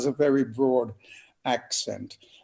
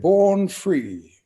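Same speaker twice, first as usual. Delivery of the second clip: loud, raised announcement of a winner; ringing, higher-pitched imitation of an announcer; award announcer; 5 semitones higher, 6 dB louder than usual. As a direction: call it out like an awards-show announcer.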